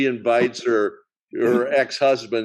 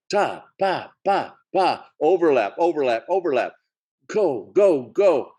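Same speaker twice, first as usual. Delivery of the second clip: higher, quick overlapping chatter sounds; rapid-fire patter; 5 semitones higher than usual. Shown as a demonstration. Host miming screwball comedy's fast overlapping dialogue, rapid patter. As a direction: rattle it off like fast comedy banter. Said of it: "they were just so fast-paced".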